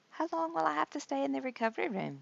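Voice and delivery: high, fast nervous question; Grover's voice. A narrator's reading. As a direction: rush the question like an anxious Grover. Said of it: "Grover asked".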